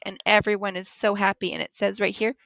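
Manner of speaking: ordinary delivery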